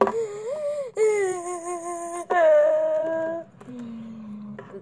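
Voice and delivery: long high wail, sliding down; gasp, then let out a falling whine; imitated long wail. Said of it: "just sitting there, like".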